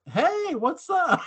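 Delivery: high, slow, sing-song hey; mock cheery greeting. Answering his friend's rude greeting, mock sweetly high and slow.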